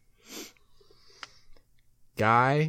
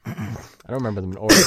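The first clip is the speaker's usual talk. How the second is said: ordinary talk, nothing marked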